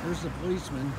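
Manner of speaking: ordinary talk, nothing marked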